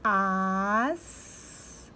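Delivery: "As" with the A first, then a long hissing S; teacher modelling the sound "As"; syllable demo. Shown as a demonstration. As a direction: sound out "As" slowly for children. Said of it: "primeiro eu tenho que fazer o som do A".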